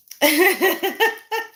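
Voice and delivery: high-pitched burst of giggles; embarrassed high giggle; giggling laugh. Real, not acted. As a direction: break into high, helpless laughter. Giggling after rude-word talk.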